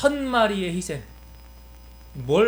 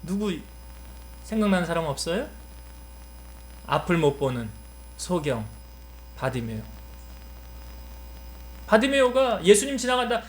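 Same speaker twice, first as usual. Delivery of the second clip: ordinary delivery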